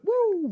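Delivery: very high whooping woo; crowd woo. Retold or imitated. The whole crowd answering, a high whooping woo. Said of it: "the whole crowd went".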